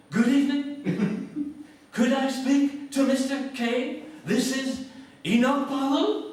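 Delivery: very high, slow, precise plummy put-on voice; a loud, slow, self-parodying posh old statesman; Enoch Powell impression. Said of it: "I'm gonna have to do an impression here".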